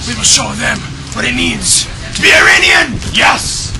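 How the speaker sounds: loud, high threatening shouts; furious captor. Acted. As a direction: bark threats at the hostages. Hostage-takers yelling threats.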